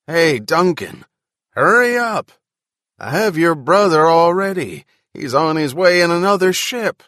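loud urgent yell from afar. A narrator's reading. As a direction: shout it across the gap. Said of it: "the man yelled once more".

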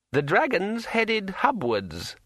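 high, slow, eerie ringing voice; like a finger round a wine glass rim; ringing metallic sword voice. A narrator's reading. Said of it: "said Kring".

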